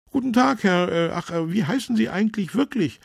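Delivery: high, loud, fumbling greeting; slightly embarrassed. A narrator's reading. Councillor greets Tomate without knowing his name, embarrassed. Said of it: "fragte Fabian Pix ein wenig verlegen".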